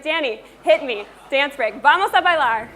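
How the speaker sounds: loud call to the DJ to start the music, laughing at the end. Real, not acted. Speaker calls out to DJ Danny to start the dance break, loudly. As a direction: fire up the room and cue the DJ.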